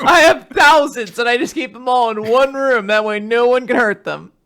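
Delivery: earnest goofy boast; silly high character voice. Retold or imitated. Voicing the character.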